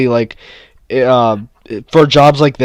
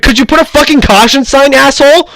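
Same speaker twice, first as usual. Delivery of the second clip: higher and louder, furious retort; retold angry yell; 11 semitones higher, 5 dB louder than usual. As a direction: snap back at the kid who caused the fall. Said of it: "I was like".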